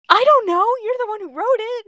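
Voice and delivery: very high, loud squawk of protest; real high-pitched comic exasperation; exasperated outburst. Real, not acted. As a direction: throw the blame back, incredulous. Teasing co-reader who wrote it.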